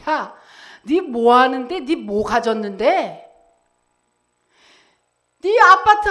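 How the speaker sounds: acted-out scornful challenge, rising in pitch; mocking jeer. The world jeering at believers, mock scornful challenge. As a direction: badger them with taunting questions, building then easing. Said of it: "세상은 우리를 위협하고 조롱하고".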